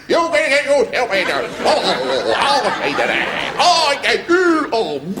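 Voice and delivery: high, loud spluttering rage; strangled high furious splutter; sergeant major losing it. Retold or imitated. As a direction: choke on his own fury, very high.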